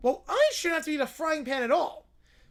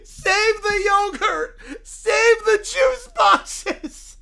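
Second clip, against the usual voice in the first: panicked screaming; 6 semitones higher, 8 dB louder, much slower than usual. Acted. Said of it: "Caillou then screams and runs".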